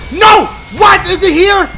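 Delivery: high, loud cries of alarm; panicked shout. Real, not acted. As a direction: shout in fright as the threat closes in. Fears the monster is near.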